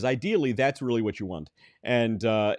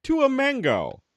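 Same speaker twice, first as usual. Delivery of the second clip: slow, higher, mocking parody of the cohost; mocking imitation; 6 semitones higher, much slower than usual. Cohost mimics David's fruit comparison in a slow, mocking voice. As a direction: drag it out to mock his comparison.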